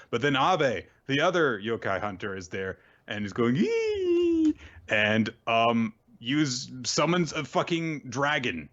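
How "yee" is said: long, very high-pitched squealed "yee"; high mock squeal. Retelling the other yokai hunter's arrival, with a high mock squeal. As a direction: let out a sustained piercing mock cry. Imitates the rival hunter's cry.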